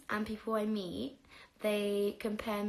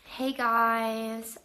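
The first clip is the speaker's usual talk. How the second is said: put-on bouncy American vlogger accent, louder; 6 dB louder than usual. Greeting viewers in her best American accent. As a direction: open like an American YouTuber. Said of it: "do my best American accent".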